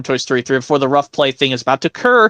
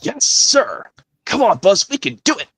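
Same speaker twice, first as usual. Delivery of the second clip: Woody voice; 4 semitones higher than usual. Acted.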